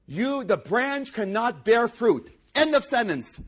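high, loud, slow pulpit emphasis; thundering revival preaching; full-force preaching. Acted. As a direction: hammer each word home.

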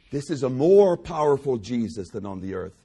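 normal talking voice

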